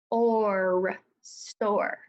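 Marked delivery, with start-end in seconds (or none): 0.1-1.0 "Or" drawn out after separate s and t; tapped-out sounds. Shown as a demonstration. Teacher tapping out store, "Or" stretched as one sound. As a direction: hold "Or" long as its own sound. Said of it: "Let's tap that out".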